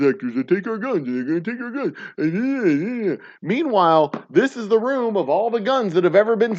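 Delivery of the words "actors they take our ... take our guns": slow, drawn-out paranoid voice; a mocking, slowed-down take on the paranoid line; mock conspiracy nut. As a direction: parody a gun-fearing conspiracy theorist.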